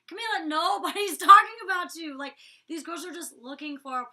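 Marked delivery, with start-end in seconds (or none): 0.1-2.2 loud, high exasperated protest; exasperated retort. Retold or imitated. Housemate answering Camila's accusation, loud exasperated retort. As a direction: protest at full volume, voice pitched up.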